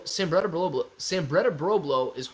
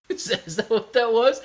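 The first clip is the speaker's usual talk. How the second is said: ordinary delivery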